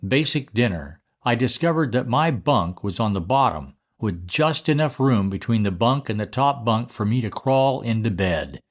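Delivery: ordinary delivery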